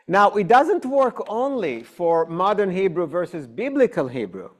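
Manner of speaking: ordinary talk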